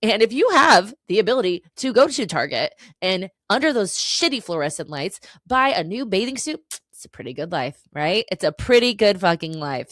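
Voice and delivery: loud, building emphasis; loud passionate rant; emphatic. Real, not acted. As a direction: hammer the point home.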